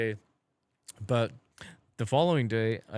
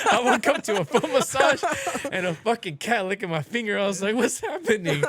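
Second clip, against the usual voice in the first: much higher and louder disbelief; excited; 10 semitones higher, 7 dB louder than usual. Real, not acted. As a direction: blurt it out amazed. Excited retelling of waking up.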